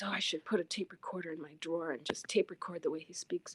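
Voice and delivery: fast, hushed whisper of a sly aside; whispered quote. Retold or imitated. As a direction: whisper it like a secret scheme. Old coworker's remark, secretly retold.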